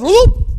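loud, high comic whoop; falling whoop. Retold or imitated. Boy tumbles into the outhouse pit, mock startled whoop. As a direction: yelp as if dropping suddenly.

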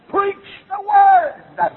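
slow, loud, high shouted charge; urgent command. Retold or imitated. Paul charging the young preacher, slow loud shout. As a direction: thunder it as a final charge. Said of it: "he looked down and said".

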